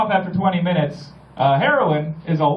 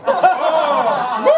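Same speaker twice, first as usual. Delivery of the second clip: higher, louder, laughing woo; excited whoop; 9 semitones higher, 4 dB louder than usual. Retold or imitated. Comedian cheering in the bathroom, laughing whoop. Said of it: "And I went".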